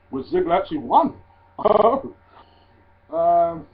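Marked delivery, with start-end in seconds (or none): 1.6-2.1 one brief, high, loud laugh; short laugh. Real, not acted. Wrestling fan reacting to a surprise win, short loud laugh. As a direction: burst out in a quick laugh.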